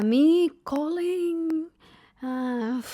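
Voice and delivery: high, very slow mock voice; slow high mockery of the driver; imitating the driver. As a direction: drag it out, mocking his broken English. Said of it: "so he's like".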